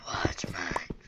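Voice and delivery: very low, hushed whisper; silly low whisper; put-on whispery voice. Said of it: "Now that voice is never gonna get out".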